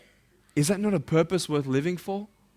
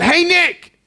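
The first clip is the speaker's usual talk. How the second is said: retold yell; 12 semitones higher, 9 dB louder than usual. Girl yells out his name.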